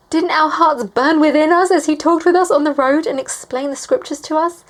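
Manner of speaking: high, loud rush of amazement; thrilled, pitched well up; excited disciples. Acted. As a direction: voice it like astonished friends reliving it. Acts disciples' excited words to each other.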